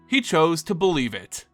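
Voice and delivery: ordinary delivery, nothing marked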